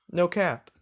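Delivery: ordinary delivery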